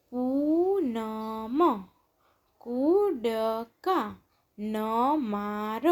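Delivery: પૂનમ, કૂદકા ન માર very slow, syllables stretched; drawn-out પૂનમ, કૂદકા ન માર, syllables separated. Shown as a demonstration. Syllable-by-syllable reading, then blended words.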